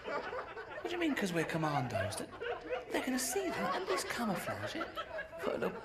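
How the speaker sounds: high mock disbelief, laughing through it; incredulous questioning. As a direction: play it as a baffled little voice quizzing soldiers. Mock bewildered questioning of commandos.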